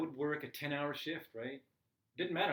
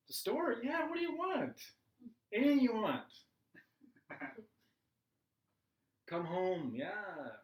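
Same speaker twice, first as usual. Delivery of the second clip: much higher, bright eager agreement; cheerful yes; 7 semitones higher than usual. Retold or imitated. Stepdad in a good mood answering the phone, gladly agreeing. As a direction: happily agree to anything.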